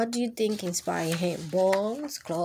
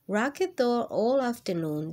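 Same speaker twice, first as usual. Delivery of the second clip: usual speaking voice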